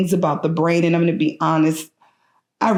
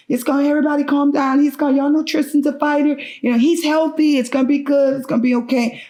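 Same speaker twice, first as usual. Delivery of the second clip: insistent reassurance; 10 semitones higher, faster than usual. Retold or imitated. Mother telling her frightened family it will be okay, high and insistent. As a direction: pitch up high, quick, urging them to calm down.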